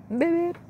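high-pitched mock beeping noise; playful beeps. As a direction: chirp a robot beep, playfully. Imitating stormtrooper sound.

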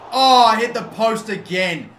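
loud, drawn-out groan of frustration, pitched high; frustrated cry. Real, not acted. Gamer hitting the post yet another time, crying out. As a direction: wail it slowly at the near miss. Hits the post once more.

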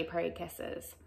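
usual speaking voice

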